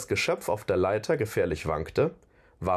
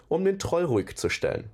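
plain reading voice

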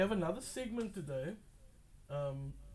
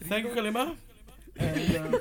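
loud announcer voice; 6 semitones higher, 8 dB louder than usual. Shown as a demonstration. Announcer voice, louder.

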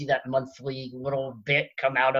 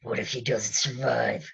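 low-key, softer masked-warrior growl; gravelly, lowered voice from behind a mask; gravelly Mandalorian; 3 semitones lower, 6 dB quieter than usual. Acted. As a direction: growl it quietly like a masked bounty hunter. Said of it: "if I just lower my voice like this".

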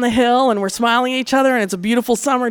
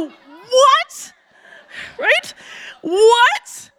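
much higher, squeaked what; stunned disbelief; 11 semitones higher, much slower than usual. Retold or imitated. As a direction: react as if the question is absurd. Stunned by liberal question.

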